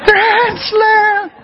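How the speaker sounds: put-on high, joyful shout of a kid; screaming kid. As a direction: yell it like a kid rounding the bases. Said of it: "he's screaming".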